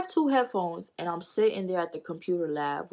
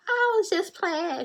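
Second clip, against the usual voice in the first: much higher, slow, sweet imitation; mock innocent backing down; 13 semitones higher, slower than usual. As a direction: play the friend pretending it was a joke.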